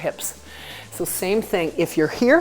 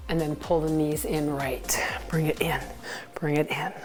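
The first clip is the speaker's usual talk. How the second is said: soft whispered instruction; whispered cue; 2 semitones lower, 6 dB quieter than usual. Real, not acted. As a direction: breathe the cue out quietly. Coach cue under the music.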